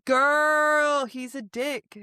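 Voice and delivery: high, drawn-out mock exasperation; exasperated reader. Reader pleading with her about her dad, mock exasperation. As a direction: wail it at her like a fed-up friend. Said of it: "And you're just like".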